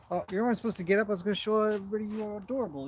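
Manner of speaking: fast, bright pet talk; excited cooing. Real, not acted. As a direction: gush over the horse quickly. Cooing at horse who got up.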